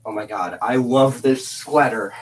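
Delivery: ordinary talk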